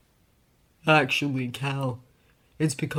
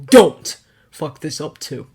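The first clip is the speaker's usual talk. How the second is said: much louder threat; forceful warning; 11 dB louder than usual. A narrator's reading. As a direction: hit him with the force behind it.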